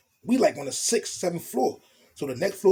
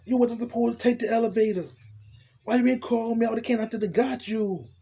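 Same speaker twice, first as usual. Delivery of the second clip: voicing the woman higher, scolding and worried; a lightly voiced, higher fretful woman; girl's worried voice; 5 semitones higher than usual. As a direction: play the anxious girlfriend fussing over him.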